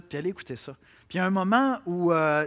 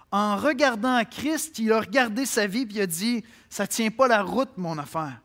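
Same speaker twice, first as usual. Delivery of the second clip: louder and higher, building preacher's cry; fervent preaching; 5 semitones higher, 7 dB louder than usual. Acted. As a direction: drive the refrain home with rising fervour. Preacher builds to full force. (prédication fervente, de plus en plus forte)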